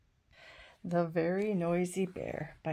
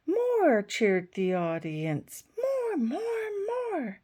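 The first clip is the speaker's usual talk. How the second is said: very high, excited cheering call; cheering crowd; 9 semitones higher, 5 dB louder, much slower than usual. Acted. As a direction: cheer for an encore at the top of your voice. Audience cheers for more.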